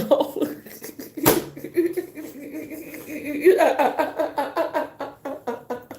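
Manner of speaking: long high squealing cackle; high cackling laugh. Real, not acted. Laughing after shocked outburst.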